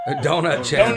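high, loud laughing cheer; laughing celebration. Real, not acted. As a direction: crow about winning.

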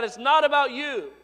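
loud, high scolding; preachy chastisement. Shown as a demonstration. Preacher scolding the congregation, loud and stern. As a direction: hammer it at them like a rebuke. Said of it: "I can preach at you like that".

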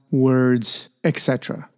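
normal talking voice

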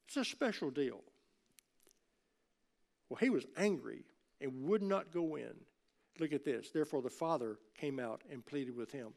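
normal talking voice

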